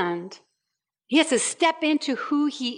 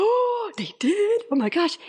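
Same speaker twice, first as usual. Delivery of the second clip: scandalised surprise; 7 semitones higher than usual. Acted. Guest reacts to the gossip.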